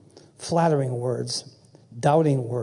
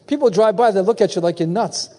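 usual speaking voice

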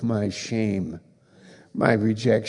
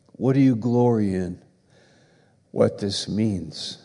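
usual speaking voice

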